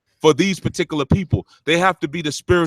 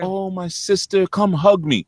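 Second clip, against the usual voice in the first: mock sympathy; 5 semitones higher, slower than usual. Mocking unwanted gushing sympathy.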